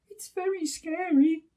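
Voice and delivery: high little scared bear voice; a small cub afraid of the dark; scared Baby Bear. Acted. Baby Bear frightened in the gloomy forest, high and small. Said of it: "whispered Baby Bear".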